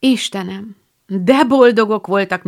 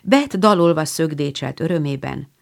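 ordinary delivery, nothing marked

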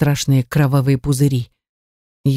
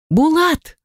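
high-pitched desperate cry of a name; 12 semitones higher than usual. A narrator's reading. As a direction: cry out in alarm, pitch leaping up. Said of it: "закричала я вместе с сёстрами".

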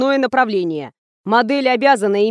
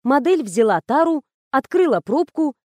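usual speaking voice

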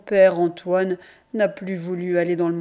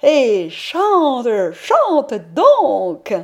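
urging; 4 semitones higher, 7 dB louder, much slower than usual. A narrator's reading. Mother urging father to sing.

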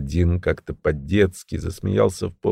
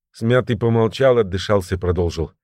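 usual speaking voice

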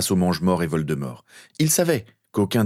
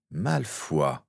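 softer, contemptuous name; spat with contempt; 2 semitones lower, 5 dB quieter than usual. Acted. Steven recognises his enemy Malfoy, contemptuous. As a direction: spit out the name, held down. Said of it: "cracha Steven".

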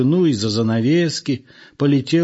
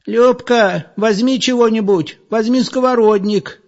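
put-on much higher, louder old woman's cry; alarmed call; 10 semitones higher, 4 dB louder than usual. Old woman calls to Lyuba in the dark, alarmed and loud. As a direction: yell for a weapon, startled.

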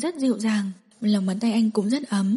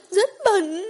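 screamed; 10 semitones higher than usual. Acted. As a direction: scream the words out. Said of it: "Diệu Tinh hét lên".

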